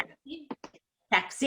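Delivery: "Taxi" as a question, ending too short; imitating student's taxi. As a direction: mimic a learner's uncertain Taxi. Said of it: "you were kinda like".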